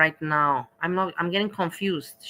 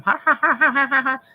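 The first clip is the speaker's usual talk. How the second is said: high, loud put-on laugh; reenacted mocking ha-ha laugh; mock laughing at him; 6 semitones higher, 4 dB louder than usual. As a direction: laugh at him, higher and louder. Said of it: "like me with the garlic bread laughing at him".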